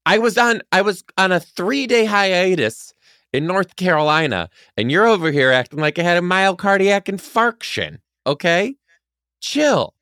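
high-pitched comic outrage; indignant outburst. Real, not acted. Mock-outraged self-defence in quarrel.

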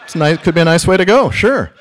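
a hammed-up corporate pushover; mock corporate yes-man. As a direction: cave in brightly to the boss. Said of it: "what I heard myself say".

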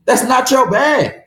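slow, high, shouted telling-off; exasperated shout. Retold or imitated. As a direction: yell it at the shooter in disbelief. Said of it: "I'm like".

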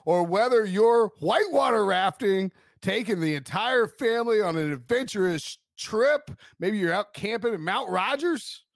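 smooth radio ad voice; ad read. Acted. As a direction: read it like a car commercial. Recorded car ad read.

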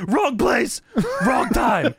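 high-pitched wrestler's shout; mock yelling. Imitating Sid yelling in Sting's face, mock yell. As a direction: yell it in an opponent's face, hammed up. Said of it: "Sid is yelling in Sting's face".

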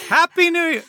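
high, loud, hammed-up New Year's shout; mock party cheer. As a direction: fake midnight excitement, way over the top. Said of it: "Where you're like".

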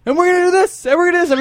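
Howard Dean rally voice. Retold or imitated. Host mimicking the candidate at his rally, hammed-up. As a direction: play it up like a candidate whipping up a crowd. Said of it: "He's like".

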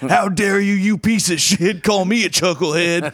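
high-pitched imitation of an indignant complainer; mock outrage. Host mimicking an irate listener's email about being called chucklehead, mock outraged. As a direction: act out an over-the-top angry threat.

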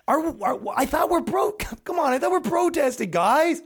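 high shouted mock whine; whiny activist. Activist hit by an egg whining at the crowd.

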